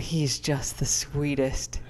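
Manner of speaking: soft, slow whispered fondness; tender whisper. Real, not acted. To the listeners about her sweet dog, a tender whisper. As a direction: breathe it out softly, full of affection.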